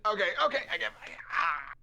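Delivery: high, loud hurried giving in; pained surrender. Acted. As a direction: beg off in pain. Knight gives up in pain.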